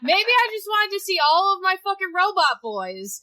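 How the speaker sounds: loud, high-pitched defensive rant; comic defiance at full volume; mock-defiant outburst. Real, not acted. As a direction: own up to it loudly and defiantly. Defensive answer about replaying a game.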